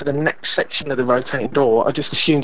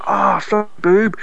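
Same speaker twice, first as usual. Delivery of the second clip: slow, high-pitched mock baby complaint; lightly voiced hungry infant, drawn out; imitating a baby; 8 semitones higher, much slower than usual. Dad voicing his baby finding no milk, mock disappointment. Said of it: "he's like".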